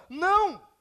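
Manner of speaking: loud, high, sharp rejection; shouted correction; emphatic no. Real, not acted. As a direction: cut the answer off with a firm no. Preacher rejects answer loudly.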